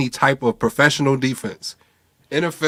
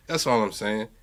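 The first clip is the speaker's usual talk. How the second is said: ordinary talk, nothing marked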